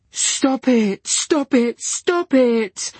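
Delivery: very high, breaking pleading; crying out. A narrator's reading. Diana crying out at Dylan, near tears. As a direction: beg through tears. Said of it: "cried Diana".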